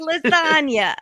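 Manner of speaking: high, hammed-up Italian-American cry; mock Italian-American food exclamation. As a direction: sing out an exaggerated Italian-American accent. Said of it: "with this guy's accent".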